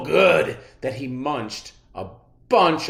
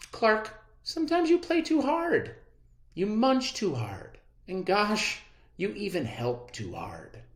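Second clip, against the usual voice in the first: lightly voiced kind teacher; gentle teacher; 10 semitones higher than usual. A narrator's reading. Teacher to Clark, gently explaining.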